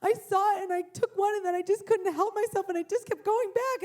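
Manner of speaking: high, loud blubbering confession; hammed-up tearful child's apology; imitating weeping child. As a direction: wail it out like a sobbing kid, words tumbling. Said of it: "full on body heaving weeping".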